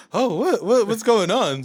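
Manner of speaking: high, rising stammered alarm; panicked confusion. Retold or imitated. Reliving seeing the Amazon charges, startled and high. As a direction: stumble over the words in shock. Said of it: "freaking the fuck out".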